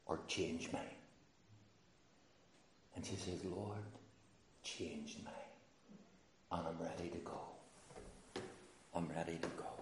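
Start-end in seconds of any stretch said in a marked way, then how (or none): 0.1-0.8 very soft, lowered praying voice; hushed prayer. Retold or imitated. Woman pleading with the Lord to change her, hushed. 3.4-9.7 very quiet, low, with long pauses; hushed prayer. Retold or imitated. Woman surrendering to the Lord, hushed prayer.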